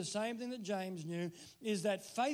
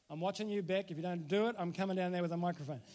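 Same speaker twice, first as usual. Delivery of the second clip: usual speaking voice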